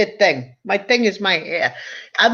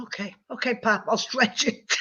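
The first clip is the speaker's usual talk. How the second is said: meek reply; 4 semitones higher, 7 dB quieter than usual. Retold or imitated. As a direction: back down quietly like a scolded kid. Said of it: "I said".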